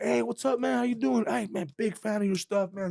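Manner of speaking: high, upbeat greeting as the owner; friendly stranger voice. Retold or imitated. Tattooed owner greets the skit maker, high and friendly. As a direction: play the friendly tattooed guy saying hi.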